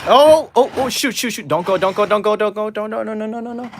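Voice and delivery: urgent panicked pleading; frantic, rising alarm. Real, not acted. Toy car about to fall.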